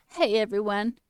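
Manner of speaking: lightly hammed-up hello to a crowd; mock conference greeting.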